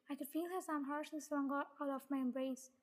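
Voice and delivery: plain reading voice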